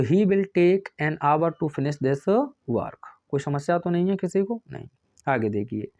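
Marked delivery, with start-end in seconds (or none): 0.0-3.1 "an hour" read slowly and evenly, word by word; slow corrected dictation. Shown as a demonstration. Teacher dictating the corrected answer, slow and deliberate. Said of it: "एन लगाओगे".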